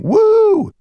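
high, loud cheering "woo"; calling whoop. A narrator's reading. Club crowd answering the voice, high calling whoop. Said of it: "several voices calling".

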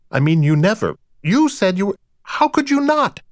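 high, rising exasperation; peevish, baffled wife. A narrator's reading. Rita demands why Dexter never mentioned his brother, peevishly baffled. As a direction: build to an incredulous high-pitched protest.

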